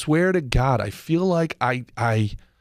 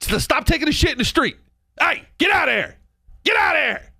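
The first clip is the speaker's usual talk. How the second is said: shouted, much higher get out of here; yelling at him to leave; 9 semitones higher than usual. Retold or imitated. Telling the man in the street to leave, shouting. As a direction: bark him away from the street. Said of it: "what's it gonna take for people to sit here and go like".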